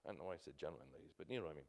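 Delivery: quick self-amused mumble under the breath; muttered aside. Real, not acted. Self-correcting aside to congregation.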